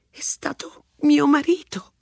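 whispered confession, hushed; confessing whisper. A narrator's reading. Wife confessing to the sergeant, in a whisper. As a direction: let it slip in a whisper. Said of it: "confessò missus Ranson".

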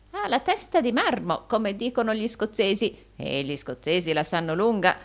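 high opening, settling then rising at the end; mildly wheedling; lightly voiced fawning old usurer. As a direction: chatter admiringly as a wily old flatterer. Gride exclaims, flattering.

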